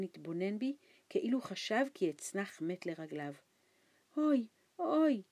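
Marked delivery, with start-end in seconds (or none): 4.2-5.2 high, loud wail of oy oy; old man's high frightened wail. A narrator's reading. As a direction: wail it out in fear. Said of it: "ייבב".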